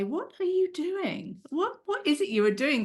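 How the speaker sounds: lightly voiced husband asking what she's doing; imitating husband. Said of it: "even my husband would say".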